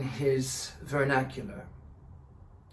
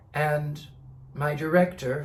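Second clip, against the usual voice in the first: ordinary talk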